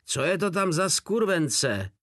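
high-pitched, mildly yelled demand; howled. A narrator's reading. Soldier demands into the microphone, mild high howl. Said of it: "zavil do mikrofonu".

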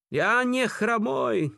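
very high, drawn-out protesting yell; acted prisoner's desperate cry. A narrator's reading. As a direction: shout the denial slowly, pitch right up. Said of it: "křičel neustále v průběhu těchto zkoušek".

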